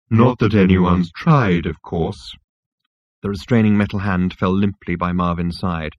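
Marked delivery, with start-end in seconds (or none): 0.1-2.4 slow, mournful robot; gloomy robot. Acted. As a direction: sigh it out hopelessly. Marvin's gloomy voice.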